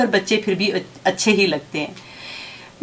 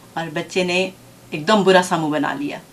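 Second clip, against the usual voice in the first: ordinary delivery, nothing marked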